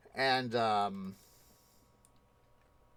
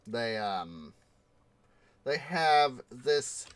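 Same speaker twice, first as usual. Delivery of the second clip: ordinary talk, nothing marked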